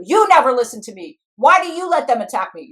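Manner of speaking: acted angry outburst; angry accusation. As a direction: lash out as a wronged inner part. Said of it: "they might get angry at you".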